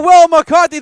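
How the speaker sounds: shouted name of the hitter; home run call. Acted. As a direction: roar the batter's name.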